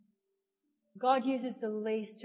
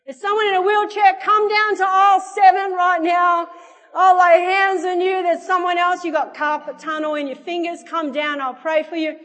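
loud, high summons to the aisles; microphone announcement; 7 semitones higher, 12 dB louder than usual. Retold or imitated. Kid summoning sick shoppers over the microphone, loud announcement. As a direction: call them down, projecting hard. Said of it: "can I use that microphone thing".

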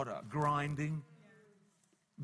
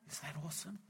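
whispered awe; 15 dB quieter, faster than usual. Real, not acted. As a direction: breathe it in a hush. Whispered aside.

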